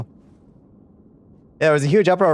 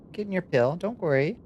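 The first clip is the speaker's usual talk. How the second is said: cooing to the cat; 6 semitones higher, 6 dB quieter than usual. Real, not acted. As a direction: coax a nervous pet gently. Coaxing cat to take pill.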